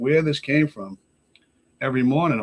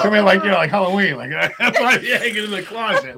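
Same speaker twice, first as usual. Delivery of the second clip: higher, louder, through real laughter; laughing; 9 semitones higher, 8 dB louder than usual. Real, not acted. Man jokes about his planned death to friends, laughing. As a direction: crack up while telling the dark joke.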